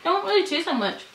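eating a wafer; chewing while talking. Real, not acted. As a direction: mouth partly full. Seen 0: middle speaker holds a bitten wafer, jaw moving between bites.

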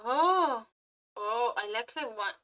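usual speaking voice

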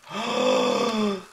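sharp frightened gasp. Real, not acted. Sudden fright.

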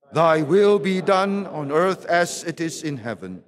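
ordinary talk, nothing marked

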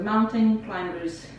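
Mountain Climbers drawn out slowly, American style; American English. Shown as a demonstration. Teacher showing the American pronunciation slowly. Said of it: "no inglês americano eles falam".

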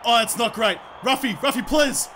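ordinary talk

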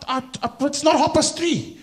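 put-on high, stuttering old lady; flustered, put-on lady pleading; very anxious. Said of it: "So she's very anxious".

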